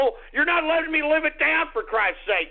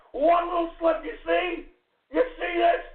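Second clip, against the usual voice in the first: ordinary talk, nothing marked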